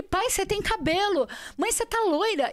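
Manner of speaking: high, loud amazed exclamation; astonished. Retold or imitated. Daughter seeing parents' changed looks, astonished. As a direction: re-enact amazement, pitched right up. Amazed retelling of her vision.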